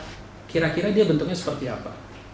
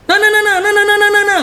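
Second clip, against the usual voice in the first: "nah, nah, nah" held much higher and louder; 8 dB louder than usual. Shown as a demonstration. Preacher demonstrating the Batak high tone, loud and high. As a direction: belt out "nah, nah" high and loud on one note. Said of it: "Naik nadanya".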